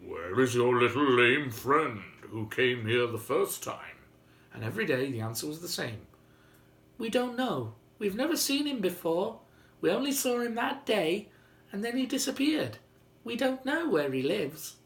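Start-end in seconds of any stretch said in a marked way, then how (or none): 0.0-4.0 a touch louder, lightly voiced giant; old giant asking. A narrator's reading. Old giant asks the children about the boy, lightly voiced. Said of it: "he asked the children". 7.0-14.8 put-on high childlike chorus; children answering. A narrator's reading. Children tell the giant they don't know, high.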